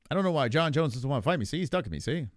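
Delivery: quick-fire fifties broadcaster impression; Tom Aspinall. Streamer posing as a snubbed fighter, hammed-up old-time broadcaster voice. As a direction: rattle it off like an old-time wise guy. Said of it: "Tom's got that w-, voice".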